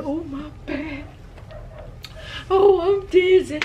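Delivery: high, slow, hammed-up groaning dad; mock complaining dad. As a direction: imitate dad moaning about his aches. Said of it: "My dad a character".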